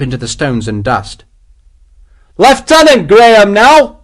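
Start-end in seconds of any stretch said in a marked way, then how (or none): 2.4-4.0 high, loud, drawn-out triumphant cry; exultant shout. A narrator's reading. Sergeant on the captured summit claims promotion, exultant shout. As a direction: shout your triumph to the skies. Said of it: "he shouted exultingly".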